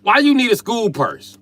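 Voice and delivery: loud exasperated dad; exasperated outburst. Real, not acted. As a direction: throw up your hands at it. Exasperated father.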